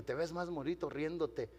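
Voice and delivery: put-on soft, gentle words to a little boy; tender. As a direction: coax him tenderly. (con ternura, en voz baja)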